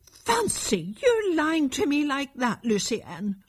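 a mild, understated angry cry. Acted. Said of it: "cried his mother angrily".

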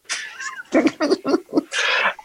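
high breathless laugh. Real, not acted. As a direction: burst into a giggle. Laughs at dad's question.

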